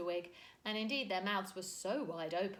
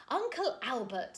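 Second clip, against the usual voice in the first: reproving; 5 semitones higher, 6 dB louder than usual. A narrator's reading. Mary Poppins reproving.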